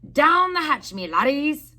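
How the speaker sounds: captain yells a rowdy toast; pirate yell. A narrator's reading. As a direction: bellow it across the table.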